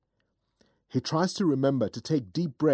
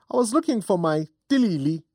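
put-on playful old woman; 7 semitones higher, 4 dB louder, faster than usual. Old woman searching her bag.

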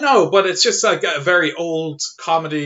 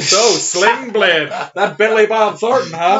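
slow, louder mock barfly question; mock pub chat; 5 semitones higher, 4 dB louder, slower than usual. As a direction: ham up a casual pub chat. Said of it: "did you go around the George asking".

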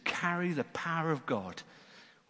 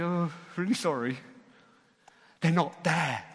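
apologetic guard; 2 semitones higher than usual. Acted. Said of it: "the guard has to come and say".

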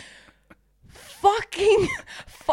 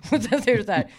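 usual speaking voice

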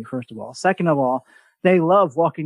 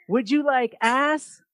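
higher, slow Texas drawl, ice heard as ass; 8 semitones higher, slower than usual. Retold or imitated. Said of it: "my introduction to a Texas accent".

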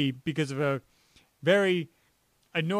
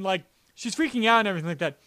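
normal talking voice

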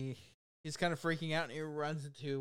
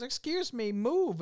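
higher-pitched mock old lady; 10 semitones higher, slower than usual. Old lady to a man in her way, higher-pitched mock indignation. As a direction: imitate an old lady wanting past. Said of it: "this old lady goes".

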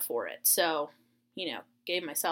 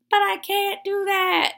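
high, loud exaggerated protest; mock frustration; 13 semitones higher, 5 dB louder than usual. Granddaughter protests to grandpa on phone, mock frustration. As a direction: whine it loudly, hammed up and pitched high. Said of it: "I was just frustrated".

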